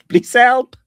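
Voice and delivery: loud, high cry for help; hammed-up panicked plea; mock screaming. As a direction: yell it high like a comic victim. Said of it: "he's screaming as the machine just goes wild".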